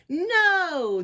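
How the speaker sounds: high, loud crowd yell; big drawn-out shout. A narrator's reading. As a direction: holler a long, united refusal. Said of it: "the crowd yelled".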